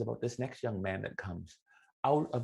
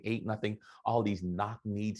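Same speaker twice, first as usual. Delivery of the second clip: usual speaking voice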